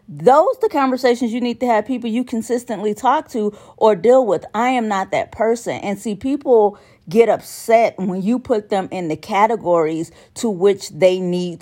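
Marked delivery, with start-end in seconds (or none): none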